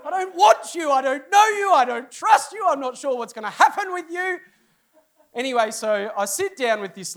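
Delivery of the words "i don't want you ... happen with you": high, loud panicked inner shout with a laugh. Retold or imitated. Pastor's silent panic at the wrong barber, loud laughing mock horror. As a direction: cry out in mock horror inside your head. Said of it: "But inside I'm going".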